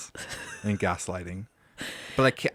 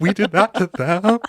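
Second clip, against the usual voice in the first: higher, louder, slowed mock-tearful wail; hammed-up tearful horror; fake crying; 9 semitones higher, 8 dB louder than usual. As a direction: pretend to be shocked to tears. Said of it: "No, he was actually crying".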